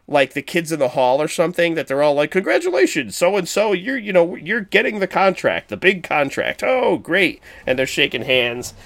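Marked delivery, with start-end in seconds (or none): 2.3-7.4 high, hammed-up congratulating; sketch-comedy congratulations. Businessmen celebrating a contract like a comedy sketch, hammed-up high gushing. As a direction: gush like a hokey sketch comedian. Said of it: "a sketch comedy show".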